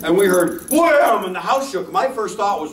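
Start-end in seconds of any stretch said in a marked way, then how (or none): 0.7-1.2 higher, louder wham; loud bang noise. Retold or imitated. Telling hosts the dog slammed the floorboards, loud wham noise. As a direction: bang it out like a slam into wood. Imitating the dog hitting the deck.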